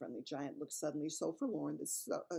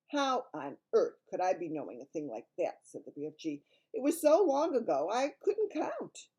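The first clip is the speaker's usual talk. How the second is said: big friendly giant voice; kindly giant voice; BFG giant voice; 8 dB louder than usual. Acted.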